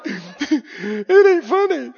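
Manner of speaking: slow, offended drawl through a laugh; mock offended. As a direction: doubters scolding the laughing crowd, mock offended. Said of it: "they're like".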